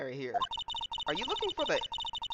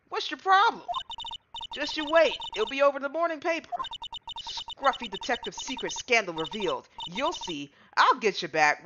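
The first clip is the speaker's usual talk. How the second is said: put-on southern accent; 5 semitones higher than usual. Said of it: "horrible country accent".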